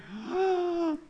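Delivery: sleepy toy voice, soft and drawn out; slow, drowsy talking-doll impression; Sleep and Snore Ernie. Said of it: "he would say".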